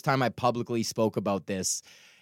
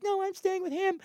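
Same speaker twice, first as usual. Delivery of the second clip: very high, strained mock voice; squeaky-high retort; imitated girlfriend; 4 dB quieter than usual. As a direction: imitate her calling back, high and quiet. Voices girlfriend yelling back.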